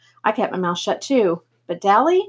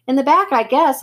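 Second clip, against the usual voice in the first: hollering; 5 semitones higher, 4 dB louder than usual. A narrator's reading. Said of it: "I hollered".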